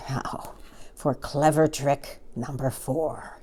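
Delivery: soft, slow sly murmur to himself; sly whisper. A narrator's reading. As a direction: plot the trick under his breath. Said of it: "he whispered to himself".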